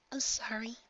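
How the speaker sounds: soft, high, breathy whisper of apology; barely holding back tears; wavering voice. A narrator's reading. Filly apologizes to Nightmare Moon, wavering whisper. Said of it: "she said, voice wavering".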